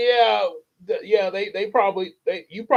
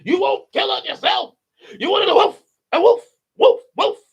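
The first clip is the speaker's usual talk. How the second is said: very high loud yapping; angry pit bull voice; 7 dB louder than usual. Retold or imitated. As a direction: yap it like an angry dog. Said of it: "sound like an angry pit bull".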